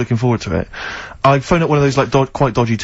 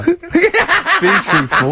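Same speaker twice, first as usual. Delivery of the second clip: high, slow, laughing mock scolding; a stern mum through laughter; 12 semitones higher, much slower than usual.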